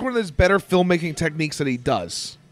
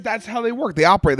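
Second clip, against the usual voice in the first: ordinary talk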